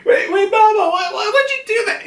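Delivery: very high cartoon squeal; hammed-up alarm in a squeaky voice; panicked protest. Zeus asks Mama why, panicked protest.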